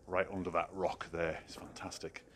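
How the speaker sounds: normal talking voice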